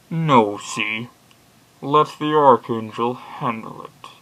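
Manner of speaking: slow, deliberate villain imitation; shaky attempt at a masked supervillain; drawn-out, commanding mock villain; Bane. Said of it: "getting bad at Bane voices now".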